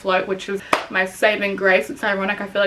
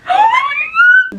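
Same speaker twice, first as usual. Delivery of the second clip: very high, squealed disbelief; shocked gasp; 7 dB louder than usual. Real, not acted. About to show viewers the response, shocked. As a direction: let it fly up in shock.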